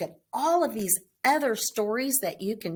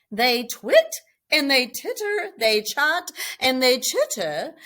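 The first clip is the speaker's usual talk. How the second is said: lively chatter; 4 semitones higher, 6 dB louder than usual. A narrator's reading. Lively read-aloud.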